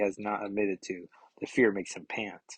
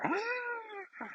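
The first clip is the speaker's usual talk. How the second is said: sudden shrill high cry of pain, much higher; mock scream; 8 dB quieter than usual. Reader voices a hero's sudden cry, mock scream. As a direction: yelp it out like a struck comic hero. Comic character cries out.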